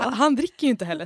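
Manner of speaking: ordinary talk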